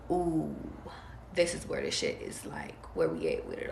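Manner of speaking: soft, breathy-low and seductive, almost a whisper; calm seductive voice. Real, not acted. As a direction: draw them in with a hushed, seductive hush. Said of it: "speaking in my calm, seductive voice".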